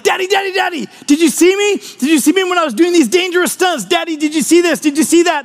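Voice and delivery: put-on high eager kid voice begging for attention; a little boy pleading to be watched; excited child. Little boy calling his daddy to watch his stunts, high and eager. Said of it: "kids be like".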